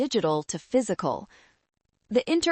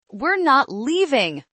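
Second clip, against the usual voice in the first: loud, high, drawn-out refusal; raised, angry narrated shout; defiant yell; 7 semitones higher, 6 dB louder than usual. A narrator's reading. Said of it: "Jessica yelled".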